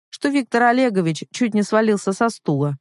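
usual speaking voice

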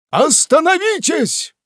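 cry out. Acted. Pickwick stopping Winkle at the fence, loud high cry. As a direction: stop them with a cry. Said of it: "вскричал мистер Пиквик".

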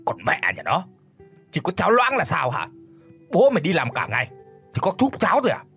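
roaring husband. A narrator's reading. Drunk husband roars at wife over porridge, furious. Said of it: "tiếng gầm của gã".

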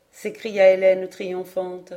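ordinary delivery

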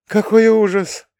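high, drawn-out gasp of horror; horrified. A narrator's reading. Mother hears of the beheading, horrified. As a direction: exclaim slowly, pitched right up. Mother reacts to gruesome news.

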